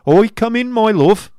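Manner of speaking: loud, high husband's call; Squeers to his wife. Acted.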